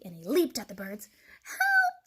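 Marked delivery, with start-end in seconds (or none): 1.4-2.1 very high, loud squeal; cried for help. A narrator's reading. Birds attacked by the fox, squealing cry. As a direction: shriek it like terrified little birds. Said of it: "they all cried".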